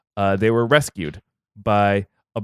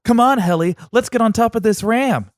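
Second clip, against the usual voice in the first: put-on eager boy Phrixus; 13 semitones higher, 5 dB louder than usual. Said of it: "He's like".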